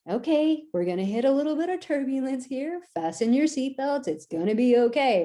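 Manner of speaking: steady, even captain's announcement; calm pilot. Retold or imitated. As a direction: reassure the cabin, unhurried.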